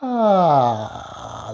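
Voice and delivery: just-woke-up vocal fry; croaky morning vocal fry. Shown as a demonstration. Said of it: "like you just woke up".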